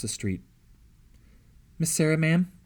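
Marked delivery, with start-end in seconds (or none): 1.8-2.5 high, sugary coaxing; butter her up with a sweet question; sweet as honey. A narrator's reading. Girl sweetly approaches Sarah for information: honeyed, higher and louder. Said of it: "sweet as honey".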